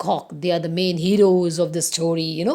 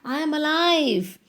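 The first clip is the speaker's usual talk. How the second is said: lightly voiced rooster, pitched up; a hint of a high bird voice; rooster character; 6 semitones higher than usual. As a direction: speak higher as the cock. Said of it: "He says".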